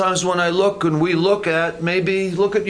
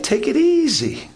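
mock casual; 6 semitones higher than usual. Mock advice to Nehemiah.

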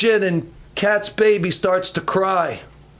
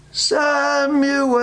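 high, long-drawn call; 8 semitones higher than usual. Acted. God calls to sleeping Samuel in the night, long high call. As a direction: stretch the name out on a high, held note. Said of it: "He knows that's God's voice".

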